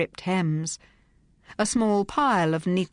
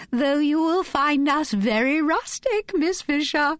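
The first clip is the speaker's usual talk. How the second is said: very high, slow, gushing Irish-free society voice; shrill society hostess; 10 semitones higher than usual. A narrator's reading. Melanie Cryer gushing at Phryne on the phone, very high and slow. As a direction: squeal it out like an affected rich hostess.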